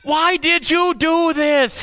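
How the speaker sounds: high, slow, pressing yell. A narrator's reading. Man holding the rope yelling at the dangling stranger, high. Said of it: "he yelled".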